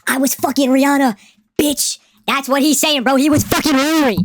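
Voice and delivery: hyped disbelief. Real, not acted. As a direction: blurt it hyped, stretching the punchline. Hyped reaction to a rap diss line.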